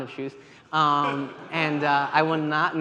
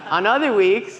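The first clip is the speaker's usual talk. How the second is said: laughing; 7 semitones higher, 3 dB louder than usual. Real, not acted. Laughing at audience reaction.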